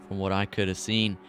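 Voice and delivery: ordinary talk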